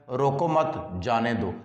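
रोको मत as one phrase, a break, जाने दो falling; group रोको with मत, then release जाने दो; pause after मत. Shown as a demonstration. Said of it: "अब ये अलामत जो सख्ता की यहाँ लगेगी". (रोको मत साथ में, फिर जाने दो)

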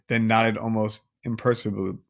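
ordinary delivery

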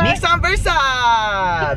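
savoring the name like Hiro Nakamura; Heroes quote. Retold or imitated. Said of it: "That's from Heroes".

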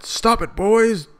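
high, loud yell to break up a fight; shouted command. Acted. Reader voicing a character who yells at the boys, loud. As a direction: bark it out to stop the boys.

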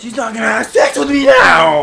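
high, loud wail of complaint; hammed-up outraged cry; mock-despairing shout. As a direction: yell it like all is lost. Mock despair after losing in game.

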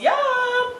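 high, held sing-out; delighted high-pitched whoop; gleeful cheer. Real, not acted. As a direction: sing out the word on one long high note. Celebrating the dish to viewers.

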